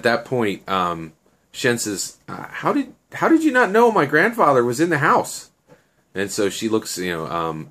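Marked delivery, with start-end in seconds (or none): 2.6-5.5 high, louder acted questioning; incredulous question. Shen confronts Annie about his grandfather in the house, incredulous. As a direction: challenge her in a raised, high-pitched voice.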